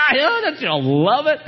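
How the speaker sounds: high, laughing glee; delighted laugh. Real, not acted. Preacher delighting in Jesus' answer, high laughing glee.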